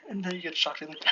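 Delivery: plain reading voice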